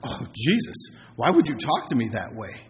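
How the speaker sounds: low, soft mock hurt; mock wounded complaint. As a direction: pretend to be hurt, voice dropped. Acts out Mary's imagined hurt complaint.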